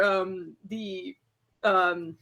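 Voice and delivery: ordinary talk, nothing marked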